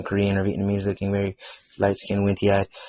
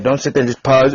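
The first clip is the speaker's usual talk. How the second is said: ordinary talk, nothing marked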